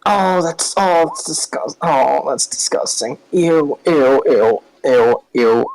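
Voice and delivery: disgusted janitor. Acted. Janet disgusted by blood.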